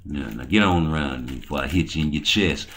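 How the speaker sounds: ordinary delivery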